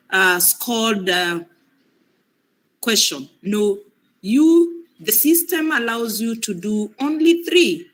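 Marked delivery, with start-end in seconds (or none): none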